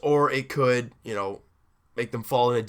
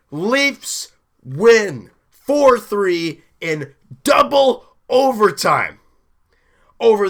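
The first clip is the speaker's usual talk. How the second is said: drawn-out triumphant announcement, much higher and louder; slow, booming victory call; excited; 7 semitones higher, 8 dB louder, much slower than usual. Real, not acted. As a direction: savour every word of the win. Celebrating the team's win.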